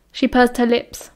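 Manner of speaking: ordinary delivery, nothing marked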